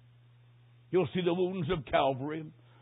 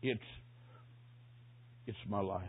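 very soft, low, halting dying man; imitated hoarse whisper; 6 semitones lower, 14 dB quieter than usual. Dying man answers the man at his bedside, acted hoarse whisper. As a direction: force out a few hushed words with long gaps. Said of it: "Then a hoarse whisper".

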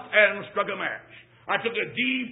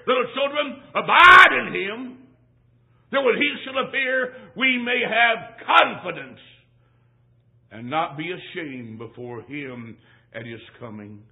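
fervent preaching; 5 dB louder than usual. Real, not acted. Preacher proclaiming Bible verse to the church, fervently. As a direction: preach it with rising fervor. Preaching scripture at full force.

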